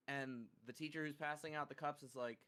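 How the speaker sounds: ordinary delivery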